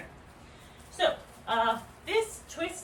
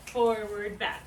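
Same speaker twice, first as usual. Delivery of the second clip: slow counted cues; slower than usual. Shown as a demonstration. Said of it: "So forward".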